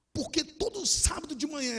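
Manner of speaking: high-pitched, anguished lament; retold tearful plea. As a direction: plead as if crying like a child. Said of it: "eu desabei a chorar igual uma criança".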